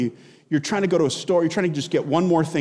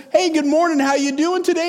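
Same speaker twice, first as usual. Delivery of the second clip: higher, louder cheerful greeting; cheery elder greeting; 9 semitones higher, 3 dB louder than usual. Retold or imitated. Elder greets a struggling member, hearty. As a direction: greet like a beaming church elder.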